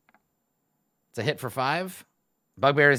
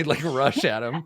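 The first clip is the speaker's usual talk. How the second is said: ordinary talk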